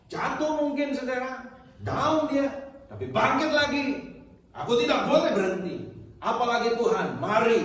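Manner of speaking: high, loud impassioned preaching; a preacher voicing the woman's desperate begging, high and loud; preaching at full force. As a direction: plead her case to the congregation at full cry.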